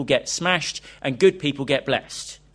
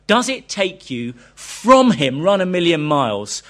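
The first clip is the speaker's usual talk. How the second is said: emphatic preaching; 3 semitones higher, 7 dB louder than usual. Real, not acted. Preacher pressing the challenge.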